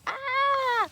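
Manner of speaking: jolted awake by a flashlight; mock startled yelp; startled shriek. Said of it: "I was like".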